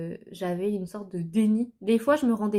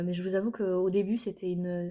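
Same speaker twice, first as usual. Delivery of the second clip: ordinary talk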